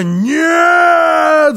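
high, long, loud shriek; frenzied scream. A narrator's reading. Housekeeper driven wild by the question, frenzied scream. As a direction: scream it out, losing all control. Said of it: "закричала она, совсем потеряв голову".

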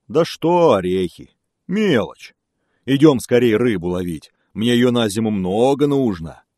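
loud, dismissive bear voice; eager bear. Acted. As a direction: wave it off like a greedy bear.

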